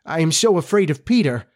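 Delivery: afraid, with the voice lifted; understated frightened confession; shaking. Acted.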